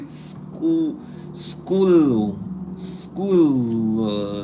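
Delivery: "school" stretched out slowly, sound by sound; approximate pronunciation. Shown as a demonstration. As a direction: draw out "school" as if testing each sound. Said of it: "something like that".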